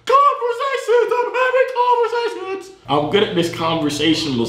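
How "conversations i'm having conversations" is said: extremely high-pitched, drawn-out squeal; gleeful shrieking. Real, not acted. Celebrating having conversations with strangers, high gleeful shriek. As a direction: shriek each word in giddy triumph.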